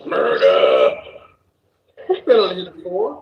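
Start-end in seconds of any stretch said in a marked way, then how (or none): 0.1-0.9 very low, loud growl; loud mock bass drop; deep murder growl. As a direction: boom it out like a gangster intro.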